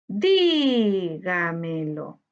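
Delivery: "Dígamelo" very slow, long stressed DÍ; stressed syllable drawn out. Shown as a demonstration. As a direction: linger on the first syllable of "Dígamelo". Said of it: "debemos buscar la sílaba tónica". ("Dígamelo" muy lento, alargando la sílaba DÍ)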